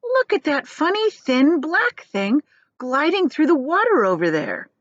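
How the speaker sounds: high, eager pointing voice; excited centipede. Acted. Centipede showing friends a strange fin, high and excited.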